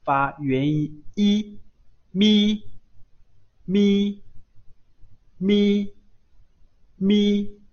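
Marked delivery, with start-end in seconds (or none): 2.1-7.6 mi drawn out loud and high, a teacher modelling the sound; teacher drilling 咪 loud and high; model kana reading. Shown as a demonstration. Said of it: "我们看一下咪的写法".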